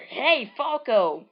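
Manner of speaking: high, loud call; loud, high hail to the bird. Acted. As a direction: shout across to him. Calling out to the falcon.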